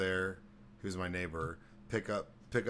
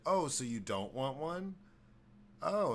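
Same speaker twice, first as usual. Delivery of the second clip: slow, wounded high oh; offended host; 7 semitones higher than usual. Retold or imitated. Neighbor feeling snubbed by the guest, slow and hurt. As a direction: act hurt the guest refused, pitch climbing.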